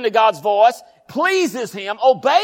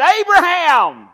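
long loud call of a name; God stops Abraham's knife with a loud call; 11 semitones higher, 6 dB louder, slower than usual. Acted. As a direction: call him out, slow and booming.

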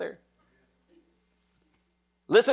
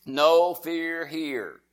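NO FEAR HERE stretched very slowly, word by word; slow, deliberate chant-like "No fear here"; leading the congregation; much slower than usual. Shown as a demonstration. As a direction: draw out each word of "No fear here" for others to join. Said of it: "Say it with me".

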